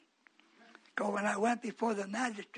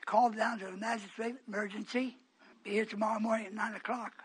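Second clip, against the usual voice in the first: ordinary talk, nothing marked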